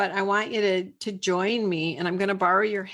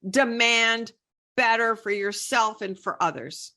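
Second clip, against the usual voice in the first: normal talking voice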